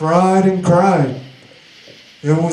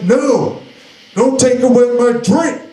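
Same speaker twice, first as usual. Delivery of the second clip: higher-pitched, mock-indignant protest; retold angry plea, pitched up; 6 semitones higher than usual. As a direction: refuse to give up the drink, indignantly. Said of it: "That's how angry I was".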